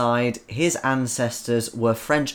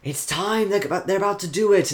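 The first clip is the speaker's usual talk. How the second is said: rising excited gasp, pitch up high; breathless countdown awe; hushed then rushing anticipation; awed characters; 6 semitones higher than usual. Retold or imitated. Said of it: "all the characters are like".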